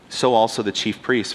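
ordinary talk, nothing marked

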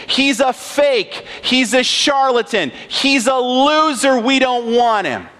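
religious crowd scorning Jesus; scornful mockery. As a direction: spit out the insults one by one. God's people mocking Jesus.

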